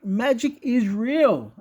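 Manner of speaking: solemn insistence; slow emphatic. Real, not acted. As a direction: lean on every word. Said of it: "emphatic claim".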